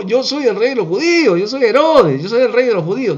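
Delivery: hammed-up royal outrage; indignant protest. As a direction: imitate a king insisting on his title. Herod indignantly asserting his kingship.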